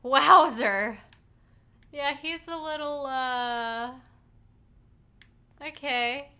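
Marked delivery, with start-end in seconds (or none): 0.0-1.0 mock echo. Echoing the game line.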